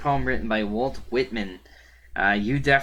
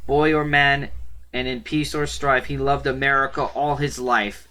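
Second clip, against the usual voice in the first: higher, louder, rhythmic verse reading; poem recitation; 4 semitones higher, 5 dB louder than usual. Acted. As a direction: declaim the verse in a steady, projected voice. Reads a poem aloud.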